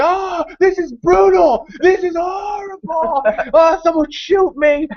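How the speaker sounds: slow, high, hammed-up wail; mock agony. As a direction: play a drawn-out death scene for laughs. Acted out being eaten by zombies.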